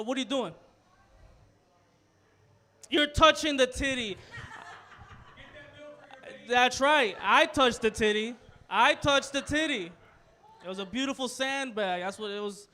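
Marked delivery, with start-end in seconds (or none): 2.9-8.4 loud, triumphant admission; proud loud confession. Acted. 8.7-9.9 slower, higher, loud and drawn out; slow emphatic repeat. Acted.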